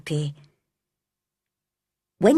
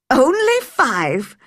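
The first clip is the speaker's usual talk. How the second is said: laughing; 5 semitones higher, 5 dB louder, slower than usual. A narrator's reading. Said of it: "laughed Dickon".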